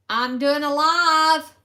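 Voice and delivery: loud call telling family she's live. Real, not acted. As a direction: call out so they hear you. Calling out to someone offscreen.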